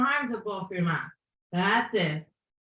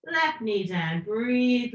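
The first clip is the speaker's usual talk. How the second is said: ordinary talk, nothing marked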